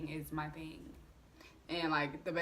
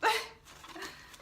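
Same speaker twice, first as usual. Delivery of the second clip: high laugh. Real, not acted. Laughs before confessing alcohol.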